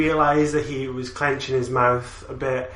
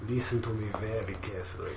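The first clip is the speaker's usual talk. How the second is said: low and much softer, demonstrating a Russian accent; Russian accent model; 6 semitones lower, 13 dB quieter than usual. Shown as a demonstration. Teaching a friend the line, low Russian accent. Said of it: "say it line by line so I can copy you".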